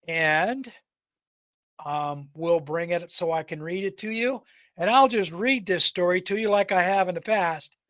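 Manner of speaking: usual speaking voice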